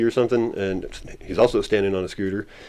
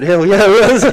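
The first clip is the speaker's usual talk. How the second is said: much higher, louder emphatic agreement; excited yes; 11 semitones higher, 9 dB louder than usual. Retold or imitated. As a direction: shout your certainty to your buddy. Confirming to friend, excited.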